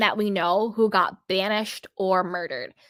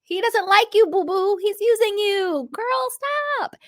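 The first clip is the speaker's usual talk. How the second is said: sassy scolding; 7 semitones higher, 4 dB louder than usual. Acted. Scolding the pick-me girl.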